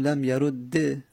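لم یرو ده read "lam yarudde"; kasra ending. Shown as a demonstration. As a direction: end لم یرو ده on doubled d and short e. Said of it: "و جایز هم کسره بدیم".

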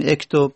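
اکتب said "ektob", opening on short "e"; kasre on alif. Shown as a demonstration. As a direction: start اکتب with an "e" instead of "o". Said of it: "چرا کسره ندادیم".

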